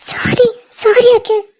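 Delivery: slow, sobbing sorry; crying apology. Acted. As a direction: beg forgiveness through tears. Apologising in tears. (அழுதுகொண்டே மன்னிப்பு)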